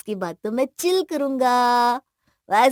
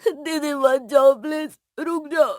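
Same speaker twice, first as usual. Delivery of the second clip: higher, sobbing plea; tearful pleading; 4 semitones higher than usual. Acted. Brother begs departing sister to stay, tearful pleading. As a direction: beg through unstoppable tears. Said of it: "आंखों के आंसू रुकने का नाम नहीं लेते".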